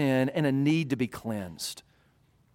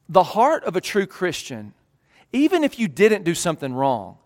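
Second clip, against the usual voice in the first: ordinary talk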